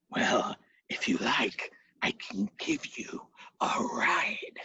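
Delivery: slow breathy whispered offer; sly whisper. Acted. Pooka offering a ride to Brian Boru, sly slow whisper. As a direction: tempt him softly, like a trap. Pooka tempts the hero slyly.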